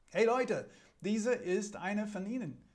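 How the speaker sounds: high, loud calling out "Hey Leute"; a servant girl raising the alarm to the crowd; accusing maid. Acted. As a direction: maid shouting to the bystanders, pointing him out. Maid accuses Peter to bystanders.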